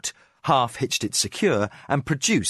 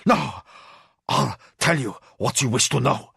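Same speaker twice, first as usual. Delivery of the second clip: hoarse, afraid. Acted. Prisoner caving to his captors, hoarse fear. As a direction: break and confess. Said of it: "he said hoarsely".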